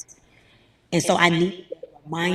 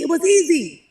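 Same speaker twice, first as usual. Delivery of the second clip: pitched well up and louder on easy; mock emphasis; 11 semitones higher, 7 dB louder than usual. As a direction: exaggerate how easy it was. Mocking the easy result.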